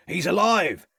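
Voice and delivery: high, loud glad shout; a lightly voiced labourer's shout. A narrator's reading. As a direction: cry out in relief. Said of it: "shouted one of the farm labourers".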